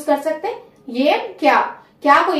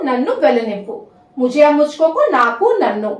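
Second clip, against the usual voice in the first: ordinary delivery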